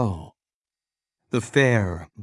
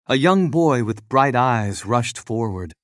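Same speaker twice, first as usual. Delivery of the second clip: ordinary delivery, nothing marked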